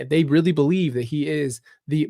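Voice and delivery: usual speaking voice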